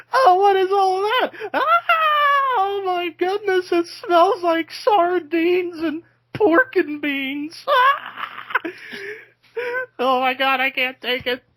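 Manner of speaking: high, loud, hammed-up wailing; Marco Rose flipping out. Imitating Marco recoiling from the birth smell, hysterical high wail. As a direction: shriek and moan in mock disgust at a stench. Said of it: "would flip his shit and go".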